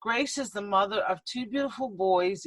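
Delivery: ordinary delivery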